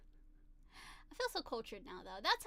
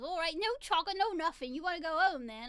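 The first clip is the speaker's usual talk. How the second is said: British mum scolding; 4 semitones higher, 5 dB louder than usual. Retold or imitated. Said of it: "the mom then goes".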